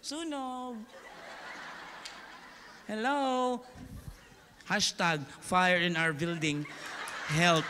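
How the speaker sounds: a hint of an unbothered Gen Z, slow and amused; deadpan like posting online; lazy Gen Z. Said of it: "Gen Z talaga". (medyo walang pakialam na Gen Z)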